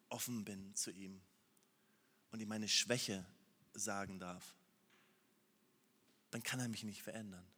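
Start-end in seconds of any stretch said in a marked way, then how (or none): none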